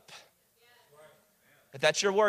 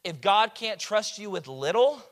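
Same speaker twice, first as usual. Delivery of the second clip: ordinary talk, nothing marked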